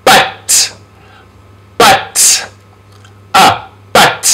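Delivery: short uh for A, crisp But; vowel demonstration. Shown as a demonstration. Teacher models vowel sound, A as short uh in But. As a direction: isolate each But with short uh. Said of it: "which is the sound A".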